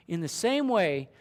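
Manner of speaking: ordinary delivery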